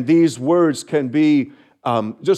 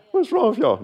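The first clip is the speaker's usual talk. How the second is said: much higher mock exasperation; playful tease; 10 semitones higher than usual. Real, not acted. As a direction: tease the crowd for not answering. Teasing the silent congregation.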